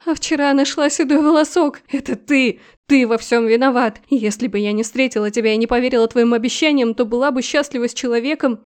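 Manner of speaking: громкий надрывный крик; сорваться на крик сквозь слёзы; крик со слезами. Acted. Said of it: "Её голос сорвался на крик, в котором стояли слёзы". (невеста в отчаянии винит жениха, громко)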